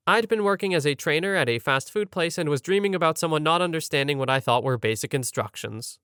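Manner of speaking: ordinary delivery, nothing marked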